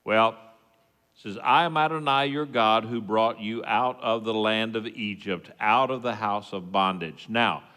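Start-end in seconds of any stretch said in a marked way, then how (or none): none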